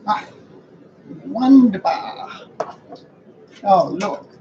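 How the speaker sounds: low, rough croaky whisper. Real, not acted. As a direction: croak it out after tea. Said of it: "That might help my croaky voice".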